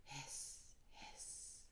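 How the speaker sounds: very soft fading hiss. Acted. The kettle, a faint hiss. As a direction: hiss almost silently. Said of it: "tea kettle whistled".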